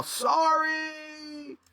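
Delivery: high, shouted cry in a strange voice; yelled apology. Acted. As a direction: yell it over the chaos. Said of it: "and I yell".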